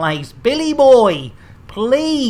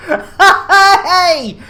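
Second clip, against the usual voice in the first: laughing protest; 12 semitones higher, 8 dB louder than usual. Real, not acted. Mock outrage at joke.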